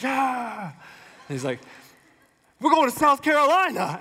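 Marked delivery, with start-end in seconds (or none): 0.0-0.6 mock crowd yeah; imitating a cheering crowd. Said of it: "People were like". 2.6-4.0 loud, higher rally shout; imitating a pumped-up candidate; Howard Dean rally cry. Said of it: "doing this".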